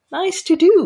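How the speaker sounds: sudden high jovial doctor voice; amused doctor. Acted. Doctor teasing the beaten captain, high amused voice.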